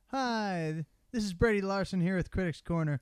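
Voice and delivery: host intro voice; upbeat segment opener; show intro. Acted. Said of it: "do I get an intro".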